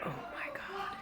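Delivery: hushed, breathless whisper; real whisper. Real, not acted. Overhearing roommates fight, she whispers. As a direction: whisper so they can't hear.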